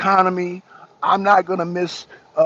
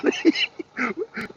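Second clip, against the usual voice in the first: high squealing laugh; 12 semitones higher, 4 dB quieter than usual. Real, not acted. Laughing at Biden stumbling.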